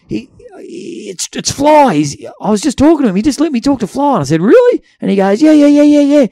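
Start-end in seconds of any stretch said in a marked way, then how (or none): 0.1-4.1 much higher and louder, fast and breathless; young fan babbling about his hero; excited young fan. Retold or imitated. Pinging young fan gushes. 4.4-4.8 very high, loud rising really; can't believe it; stunned reply to the young fan; incredulous. Retold or imitated. Disbelieving reply. 5.4-6.3 high, loud rapid yeahs; excited kid insisting to the storyteller; eager insisting. Retold or imitated.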